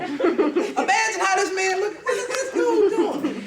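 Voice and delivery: mock the husband's baffled stare, cracking up; laughing incredulity. Uncle reacting, told laughing.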